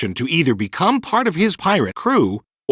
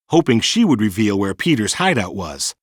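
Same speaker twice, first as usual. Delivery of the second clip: ordinary delivery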